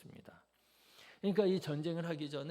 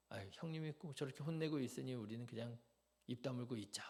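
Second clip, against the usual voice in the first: quiet aside; 2 semitones lower, 8 dB quieter, faster than usual. Retold or imitated. Onlookers muttering aside.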